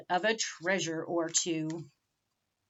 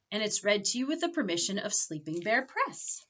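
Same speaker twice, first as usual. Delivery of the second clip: usual speaking voice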